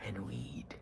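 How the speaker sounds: whispered aside. Pretend. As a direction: drop to a whisper for the punchline. Whispered punchline.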